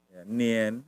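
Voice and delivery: ordinary talk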